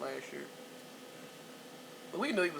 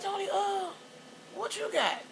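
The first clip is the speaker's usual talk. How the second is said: high, sly ask; high probing question. Retold or imitated. Mother questioning stoned Tony, high and knowing. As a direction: call him out, voice up high. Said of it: "I'm like".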